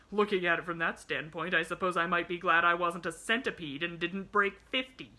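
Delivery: loud, scornful grumbling; cross bedridden man, sarcastic. A narrator's reading.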